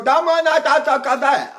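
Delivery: "Dame una taza de café" high and loud with distorted open vowels; wrong articulation. Shown as a demonstration. Teacher showing how not to talk, "Dame una taza de café" high and distorted. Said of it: "I don't talk like that".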